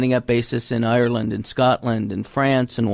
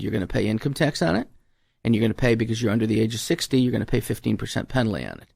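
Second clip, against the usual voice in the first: brisk rundown; faster than usual. Retold or imitated. Rattling off the penalties.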